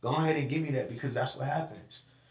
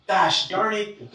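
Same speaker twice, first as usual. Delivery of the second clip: slow, prim fake restraint; a slow, prissy mock curse with no real anger behind it; mock polite cursing; 5 semitones higher, 4 dB louder, much slower than usual. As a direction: drag it out like a goody-two-shoes holding back a curse. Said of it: "That's fake".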